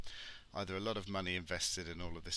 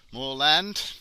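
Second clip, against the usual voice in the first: "More land" much higher and louder, rising; mock amazement at "More land"; sarcastic exclamation; 8 semitones higher, 13 dB louder than usual. Real, not acted.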